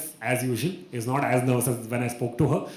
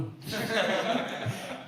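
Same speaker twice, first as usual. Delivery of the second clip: high laugh; 10 semitones higher, 5 dB quieter than usual. Real, not acted. Laughing at own song choice.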